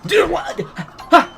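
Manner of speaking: high, loud disbelieving yelp; real surprise, voice jumping up high; shocked outburst. Real, not acted. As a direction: react to something that makes no sense. Sudden shock at the game.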